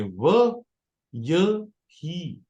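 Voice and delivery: व, pause, य, then ही, very slow; slow demo of व य ही in separate beats; break between syllables. Shown as a demonstration. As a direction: isolate व before spacing out य ही. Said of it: "यानी कि break लानी है".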